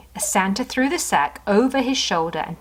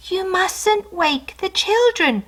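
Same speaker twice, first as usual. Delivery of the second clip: much higher, slow hush; sleepy kitten; 11 semitones higher, slower than usual. Acted. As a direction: hush him drowsily.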